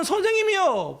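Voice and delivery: high, pleading call of teacher; high desperate plea to the teacher; high crying out. Retold or imitated. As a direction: cry it out high and long, pleading. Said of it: "불렀단 말이에요".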